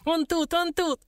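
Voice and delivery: very high excited squeal; high-pitched, triumphant squeak; squealing dwarf. A narrator's reading. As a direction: squeal the news like a tiny imp. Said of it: "верещал малютка".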